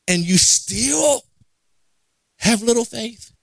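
drawn-out, incredulous question; slow reproachful questioning; acted exasperation. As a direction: stretch the words in mild disbelief. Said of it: "feeling some type of way".

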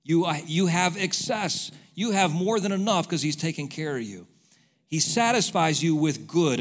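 normal talking voice

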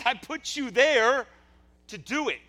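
high, loud, drawn-out exasperated shout; acted fed-up yell; frustrated answer. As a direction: throw it back slowly and forcefully. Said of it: "I think he's frustrated".

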